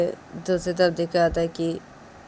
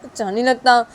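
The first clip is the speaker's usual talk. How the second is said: higher, hurt plea; higher pleading character voice; 9 semitones higher, 5 dB louder than usual. Acted. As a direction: plead to be forgiven.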